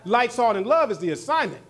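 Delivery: emphatic pulpit declaration; full-force preaching. Real, not acted. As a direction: drive the point home to the congregation. Drives the point home.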